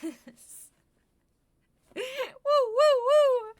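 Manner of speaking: high loud whooping; excited whoops. Real, not acted. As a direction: cheer it out. Cheering after toque sync.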